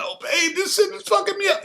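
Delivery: high, soft whimpering plea; mock a man whining to his babe; hammed-up tearful whine; whiny crybaby. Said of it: "you wanna be in that".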